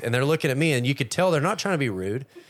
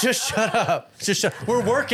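high, mock-stern shushing; higher pitched pretend reprimand; mock scolding; 8 semitones higher, slower than usual. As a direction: playfully tell the room to shut up. Mock-scolding the peanut gallery.

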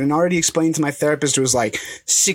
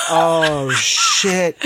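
laughing oh shit. Retold or imitated. Teller, spotting her across the class, laughing. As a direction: caught out, laughing through it. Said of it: "and I was like".

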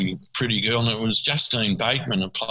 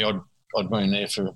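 usual speaking voice

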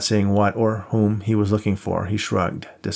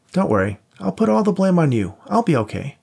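a hint of a higher, teasing pal; lightly voiced buddy; 8 semitones higher, 3 dB louder than usual. A narrator's reading. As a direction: play the cocky friend lightly. Voiced friend Max.

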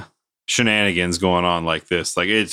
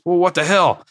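higher, exasperated what the hell; a touch of boss fury; mock angry boss; 9 semitones higher than usual. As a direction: boss blowing up at the crew. Boss angry at retold blunder.

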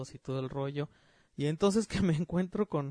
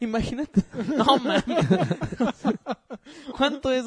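loud laughing high; 7 semitones higher, 8 dB louder than usual. Real, not acted. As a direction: laugh through the words. Laughing at doll price.

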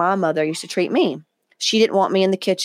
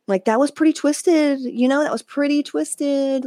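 higher, lightly mocking meme voice; 7 semitones higher, slower than usual. Quoting a meme about her dad, lightly higher. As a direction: quote the meme in a sing-song higher pitch. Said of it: "like the meme".

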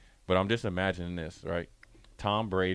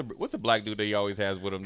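ordinary delivery

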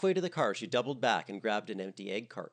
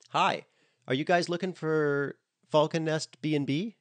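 bright, strained friendly greeting; fake-cheerful hello; forced smile; 8 semitones higher, 7 dB louder than usual. A narrator's reading. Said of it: "with a forced smile".

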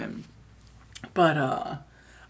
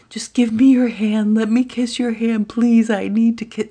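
much higher, louder pleading; begging drunk girl; 8 semitones higher, 5 dB louder than usual. Retold or imitated. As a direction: whine for a kiss like a tipsy kid.

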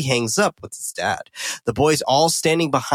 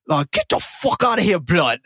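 high-pitched imitation British street slang accent; 5 semitones higher than usual.

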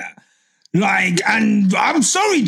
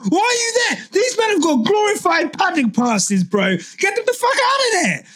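angry rant; 9 semitones higher than usual. Real, not acted. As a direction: let it all out, outraged. Ranting about pit wall.

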